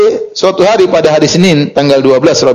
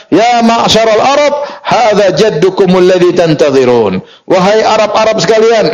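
raised, high-pitched announcing call; imitated loud call; 5 semitones higher than usual. Impatient lookout crying out to the Arabs, imitated high call. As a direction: call out urgently, mimicking a man shouting. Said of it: "dengan suaranya yang paling kencang dia berkata".

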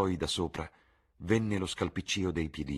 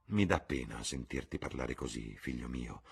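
saddened murmur; 4 semitones lower, 6 dB quieter than usual. A narrator's reading. Mother saddened by son.

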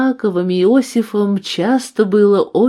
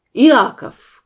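резко громче и выше, короткий окрик; окликни сына криком; выкрик; 6 semitones higher, 7 dB louder than usual. A narrator's reading. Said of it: "крикнула Мария". (громкий окрик матери)

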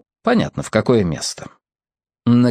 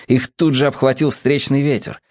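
ordinary delivery

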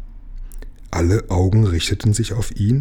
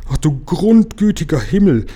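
high, louder cry of alarm; dismayed cry; 8 semitones higher, 4 dB louder than usual. A narrator's reading. Frau Waas realises the danger and cries out in dismay. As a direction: throw up your hands in horror. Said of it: "rief Frau Waas".

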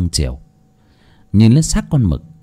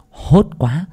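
ordinary delivery, nothing marked

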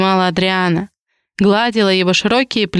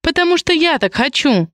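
higher-pitched, decisive comeback; lightly voiced insistence, higher in pitch; emphatic retort; 7 semitones higher than usual. A narrator's reading. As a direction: state it as final, pitch raised. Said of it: "припечатала Ри".

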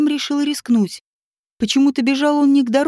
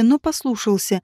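usual speaking voice

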